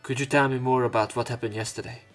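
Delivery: ordinary talk, nothing marked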